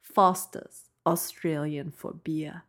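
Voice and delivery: slow Australian accent, savoring the slogan; unhurried mock Australian slogan. Shown as a demonstration. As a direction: stretch it out in a put-on Australian accent. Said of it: "I think that sounds much better".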